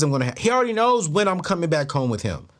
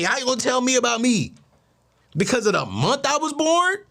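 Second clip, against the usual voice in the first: high-pitched mock outrage; offended disbelief, leaping up on the questions; incredulous protest; 5 semitones higher than usual. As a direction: act out baffled indignation, pitch riding high. Acted indignant reaction to being judged.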